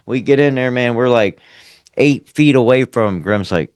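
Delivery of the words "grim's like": normal talking voice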